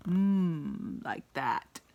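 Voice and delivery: grumpy frown sound. Shown as a demonstration. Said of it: "His eyebrows were bunched up".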